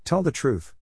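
narrated impatient mother, understated; loud and impatient. A narrator's reading. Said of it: "her voice loud and impatient".

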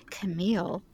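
low, soft utterance of the name; soft murmur. Shown as a demonstration. Showing a friend ways to say her name, low and soft. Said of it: "I can only do, like".

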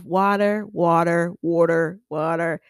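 "water" four times, each vowel slightly different; subtle vowel changes. Shown as a demonstration. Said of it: "all these very subtle changes".

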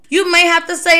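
ordinary delivery

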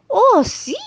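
sudden high, loud delight; excited yes. Acted. Star man answers the old woman, high delighted yes. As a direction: burst out with joy.

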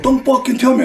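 high, snapping mock retort; hammed-up petulant outburst; mock angry rapper. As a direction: yelp back like an offended artist. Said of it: "He goes".

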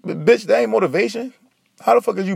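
exasperated high retort. Real, not acted. Angry rant retort.

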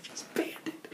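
whispered, barely voiced; voiceless murmur of disbelief; hushed aside. Real, not acted. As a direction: drop to an amused whisper. Laughing aside about the ban.

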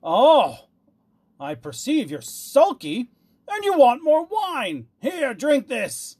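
high, loud tyrant's tirade; fierce. A narrator's reading. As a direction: rage and bully him into drinking. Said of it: "cried the tyrant fiercely".

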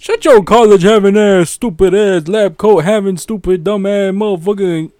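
loud, rapid-fire mocking insults; hammed-up yelling roast. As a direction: tear into your friend for laughs. Roasting a friend's question.

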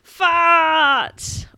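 big, high, drawn-out shout; explosive storytime climax; triumphant shout. A narrator's reading. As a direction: bellow the punchline, stretching it out.

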